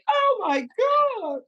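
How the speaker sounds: very high, amazed gasp of a customer; imitated excitement. Watching over customers' shoulders, imitated amazed excitement. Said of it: "just wait for their excitement".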